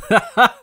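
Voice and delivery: a bright burst of laughter, loud and high; short laugh. Real, not acted. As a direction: chuckle at your own plan.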